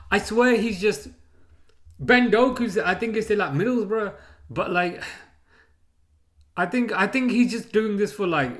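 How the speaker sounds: loud, high outraged rant; heated rant. Real, not acted. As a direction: rant at him, fully worked up. Rant about selling Salah.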